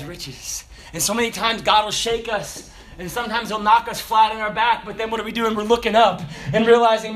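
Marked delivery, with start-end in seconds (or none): none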